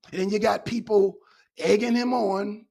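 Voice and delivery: normal talking voice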